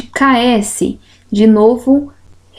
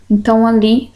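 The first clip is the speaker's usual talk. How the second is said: usual speaking voice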